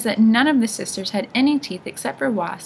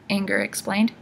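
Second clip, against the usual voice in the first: plain reading voice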